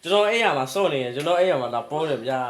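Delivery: loud words broken by laughter; laughing loudly. Real, not acted. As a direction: laugh through the announcement. Laughing loudly after the find.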